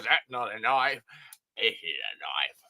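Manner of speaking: a hint of the Aussie knife boast; Crocodile Dundee quote. Host quoting Dundee's knife line, lightly voiced. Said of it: "And he says".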